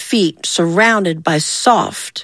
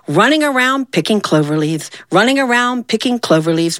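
ordinary delivery, nothing marked

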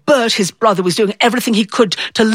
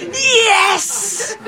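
much higher cheer breaking into laughter; triumphant yes; 12 semitones higher than usual. Real, not acted. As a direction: crow with victory. Triumphant girl after her shout.

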